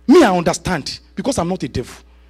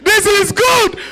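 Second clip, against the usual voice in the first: laughing delight; 13 semitones higher than usual. Real, not acted. Preacher laughs to the congregation about American sick pay. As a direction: crack up at the very idea.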